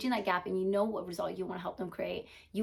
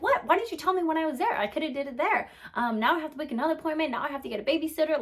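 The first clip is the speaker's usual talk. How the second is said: exasperated complaint; 6 semitones higher, 4 dB louder, faster than usual. Retold or imitated. Customer upset at late call.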